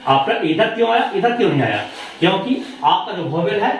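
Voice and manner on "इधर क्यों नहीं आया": normal talking voice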